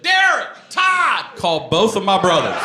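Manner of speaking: high, loud gleeful shout; dad calling brothers. Retold or imitated. Dad shouting for the brothers to come see, high and loud. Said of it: "He said".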